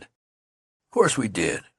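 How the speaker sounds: slow, firm "Of course we did"; stout declaration. Acted. Friend backs up the youth: stout firm declaration. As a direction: plant your feet and insist. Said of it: "declared the friend stoutly".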